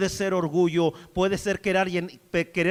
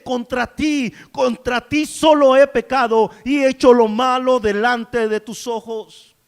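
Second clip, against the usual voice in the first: louder crying out of confession; the psalmist shouts his confession, then fades; anguished cry; 4 semitones higher, 9 dB louder, slower than usual. Acted. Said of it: "Es un grito que dice".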